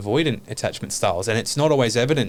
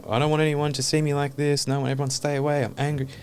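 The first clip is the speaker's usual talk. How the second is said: put-on freaked-out inner child; 5 semitones higher than usual. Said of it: "while he's in charge, freaking out, going".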